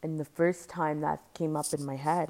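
usual speaking voice

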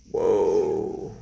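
low, drawn-out "whoa"; dropped-pitch, astonished aside; stunned whoa. Retold or imitated. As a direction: let out a deep, taken-aback whoa. Reacting to the nurse's alarming remark.

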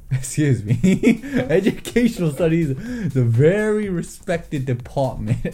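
indignant giggling defense of his course; laughing protest. Real, not acted. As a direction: laugh while pretending to be offended. Mock offended defense, laughing.

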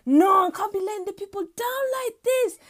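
high, agitated protest; worked-up, hammed-up panic; stressed outburst. September pacing as everyone counts on it, agitated outburst.

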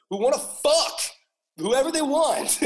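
high, loud cry breaking into a laugh; emphatic rant. Real, not acted. Host ranting in defence of who people love, emphatic shout. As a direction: belt it out high and drawn out. Ranting about protesters' targets.

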